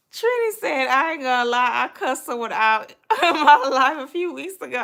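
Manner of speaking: loud, high, laughing retelling. Real, not acted. Reading out Trini's cussing confession, loudly amused. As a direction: read it out while cracking up.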